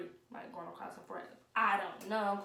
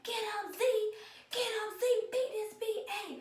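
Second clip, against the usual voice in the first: high-pitched cheering yell, higher; mock yelling cousins; 13 semitones higher than usual. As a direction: holler like cousins urging from the sidelines. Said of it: "yelling in the background".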